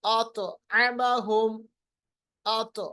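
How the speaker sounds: अतअमहुम slow, with a bounce on the त; qalqalah on ta. Shown as a demonstration. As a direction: echo the heavy त in अतअमहुम. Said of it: "इस पे भी इसी तरह हम करेंगे". (अतअमहुम में तौ का क़लक़ला)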